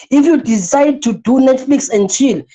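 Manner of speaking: ordinary talk, nothing marked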